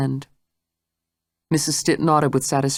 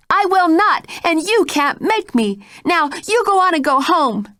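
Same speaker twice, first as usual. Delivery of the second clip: much higher, louder refusal; shrill defiance; 14 semitones higher, 4 dB louder than usual. Acted. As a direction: shout her down.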